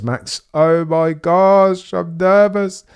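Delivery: slow, drawn-out imitation of a nervous Michael; a touch of fake nerves, stretching the words out; mock nervous quote. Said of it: "Michael says".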